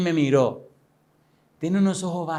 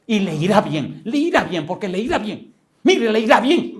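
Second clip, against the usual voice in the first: trembling, frenzied; 5 semitones higher, 4 dB louder than usual. Retold or imitated. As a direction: warn him in a rising frenzy, words pressed out. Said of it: "se puso así tembloroso".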